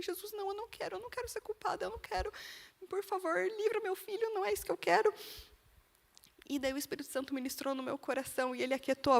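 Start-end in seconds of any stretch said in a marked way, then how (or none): 0.0-5.1 much higher, soft, tear-choked pleading; crying out in tears. Retold or imitated. Mother begging Jesus to free her son, tearful high plea. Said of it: "eu comecei a chorar, chorar, chorar".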